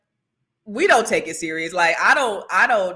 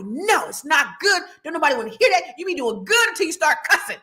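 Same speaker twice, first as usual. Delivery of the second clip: dad scolding; 9 semitones higher than usual. Retold or imitated. Retelling her dad lecturing her in a higher mock-scolding shout. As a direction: hammer the scolding like a strict dad. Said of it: "And he's like".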